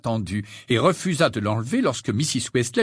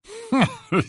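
high burst of dog-like laughter; barking laugh. A narrator's reading. Godfather bursting out at the girl's question, barking laugh. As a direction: laugh out in a sudden bark. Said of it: "éclata de son rire habituel".

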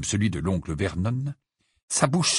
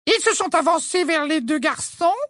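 lightly voiced old neighbour; high old lady, growing firmer; old woman voice; 3 dB louder than usual. A narrator's reading. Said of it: "la voix plus forte et plus assurée".

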